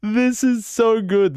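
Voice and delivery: high-pitched, drawn-out squeal of delight; overjoyed excitement. Real, not acted. Streamer thrilled to find the clip, squealing with excitement. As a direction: gush slowly in a very high voice.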